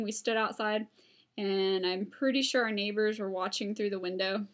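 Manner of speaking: usual speaking voice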